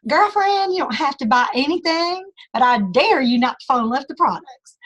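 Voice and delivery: put-on Southern accent. Sales coach imitating Christy Cox's pitch in a Southern accent. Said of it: "if you need to put on a Southern accent".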